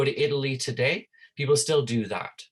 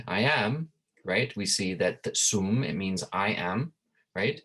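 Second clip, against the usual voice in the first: usual speaking voice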